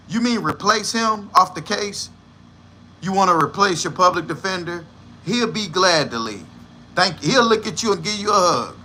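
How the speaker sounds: ordinary talk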